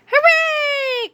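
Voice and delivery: put-on high, squealing kid cheer; children cheering. A narrator's reading. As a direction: cheer like a classroom of happy kids. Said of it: "cried the children".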